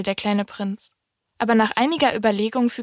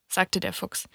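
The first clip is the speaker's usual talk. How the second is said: ordinary delivery, nothing marked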